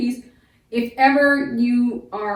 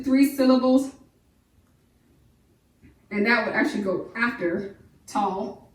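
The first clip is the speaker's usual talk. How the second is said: normal talking voice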